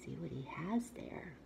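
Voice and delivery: soft whispered aside. Real, not acted. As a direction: whisper it like a secret. Aside to child listener.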